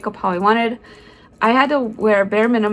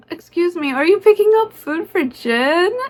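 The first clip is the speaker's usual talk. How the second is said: sweet, raised polite voice; polite high question; 7 semitones higher than usual. Retold or imitated. Asking the staff man politely, pitched up. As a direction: ask him up high and polite.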